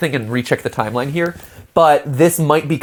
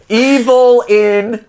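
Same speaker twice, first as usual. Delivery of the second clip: slow dramatic reveal; 5 semitones higher, much slower than usual. Pretend.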